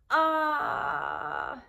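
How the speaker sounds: drawn-out, high "ahhh" fading away; loud, high vowel held long, then easing off; long demonstrated "ah". Shown as a demonstration. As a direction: stretch out a high "ah" for a baby. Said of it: "every time you hug your baby, say".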